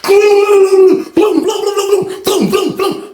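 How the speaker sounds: high imitated flurry of blows, fading; burbled high cartoon fight sound; rapid-fire "blum" noises.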